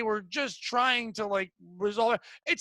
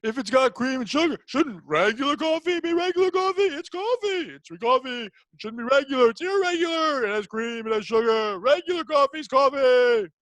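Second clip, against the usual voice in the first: hammed-up observational comic; Seinfeld impression. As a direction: do Jerry Seinfeld's puzzled rant. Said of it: "This is gonna be Seinfeld".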